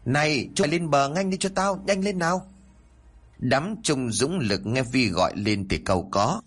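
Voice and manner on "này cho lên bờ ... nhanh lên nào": pitched well up, calling across the river; calling out loudly. A narrator's reading. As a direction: call the boys out of the water. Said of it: "gọi lớn". (gọi lớn, giọng cao vọng ra sông)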